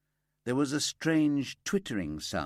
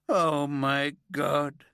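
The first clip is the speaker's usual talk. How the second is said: slow, louder anguished cry, higher; stricken cry; 6 semitones higher, 5 dB louder, much slower than usual. A narrator's reading. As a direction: cry out as if struck by the news. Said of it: "Braithwaite cried out, stricken".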